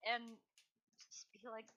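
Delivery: usual speaking voice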